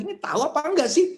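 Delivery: high, loud impatient ask; exasperated question. Acted. Friend annoyed at the vague reply, asking high and loud. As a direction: demand a straight answer.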